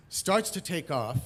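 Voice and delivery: ordinary delivery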